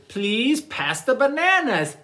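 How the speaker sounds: sweetly polite request. Acted. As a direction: ask politely in a lifted voice. Chimpanzee asks politely.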